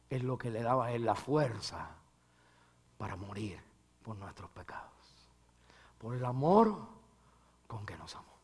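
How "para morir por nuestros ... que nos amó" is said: hushed whisper on the point; whispered sermon point. Real, not acted. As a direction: lean in and breathe it. Preacher whispers closing thought.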